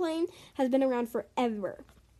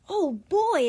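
louder, higher short exclamation; exclaiming; 5 semitones higher, 5 dB louder than usual. A narrator's reading. Jordan reacting to the coming takeoff, exclaiming. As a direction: blurt it out. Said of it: "exclaimed Jordan".

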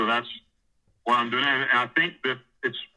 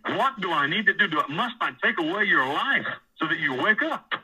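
higher-pitched, exasperated retold questions; exasperated scolding; 6 semitones higher than usual. God trying to get a prisoner's attention, exasperated. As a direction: imitate an authority at the end of patience.

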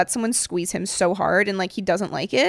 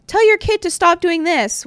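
mock demand; 9 semitones higher, 4 dB louder than usual. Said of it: "I'm not gonna go up to the parent and be like".

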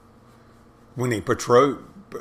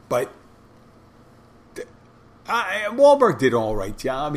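ordinary talk, nothing marked

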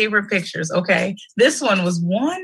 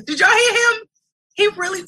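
aunt hyped over her nephew; excited yell; 13 semitones higher than usual. Real, not acted. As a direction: yell it in disbelief to the viewers. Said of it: "I'm yelling".

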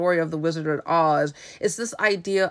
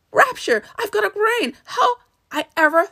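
hammed-up jubilation; overjoyed Scarecrow; 12 semitones higher than usual. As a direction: exult in a pitched-up character voice. Scarecrow rejoices at his brain.

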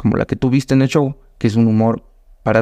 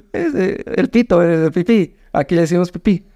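high, laughing explanation; amused explaining; 5 semitones higher than usual. Retold or imitated. The narrator explaining the word to Mexican comics, high and amused. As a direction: explain it while barely holding in a laugh.

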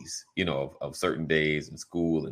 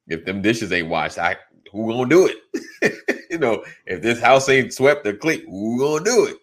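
raised, higher nagging voice; mock fed-up parent; 5 semitones higher, 6 dB louder than usual. Parent fussing at kids over unwashed dishes, raised voice. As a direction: play the fed-up parent asking who will do the chores.

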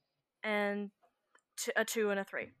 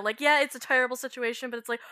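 usual speaking voice

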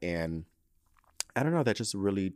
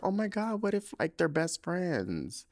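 teary; 8 semitones higher, slower than usual. Real, not acted. Retells tearful realization on stairs.

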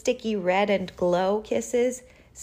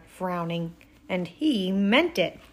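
usual speaking voice

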